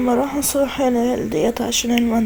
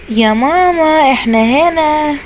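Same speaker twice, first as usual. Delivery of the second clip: put-on high, slow little-kid voice; child voice; 6 semitones higher, 3 dB louder, much slower than usual. Children answering their mother in high, drawn-out voices. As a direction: pipe up like small children.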